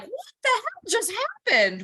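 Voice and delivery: high, startled squeal of disbelief; mock shock. Driver on the roadside, faking total surprise, high mock shock. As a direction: shriek it like the car died out of nowhere. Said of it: "we're not like".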